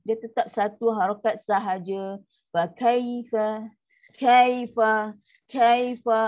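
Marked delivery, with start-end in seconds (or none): 2.5-6.2 "kaifa" slow then louder, voice dropping; slow sinking recitation. Shown as a demonstration. Teacher models the correct sinking "Fa kaifa". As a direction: draw out "kaifa" and drop it. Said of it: "Ha, baru dia turun".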